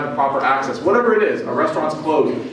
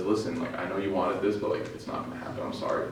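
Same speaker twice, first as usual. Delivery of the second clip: very soft, low, gentle letdown; compassionate; 8 semitones lower, 13 dB quieter than usual. Retold or imitated. Prophet tells Mughith it won't happen, softly compassionate. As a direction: break bad news gently, almost under the breath. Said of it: "full of compassion, full of mercy".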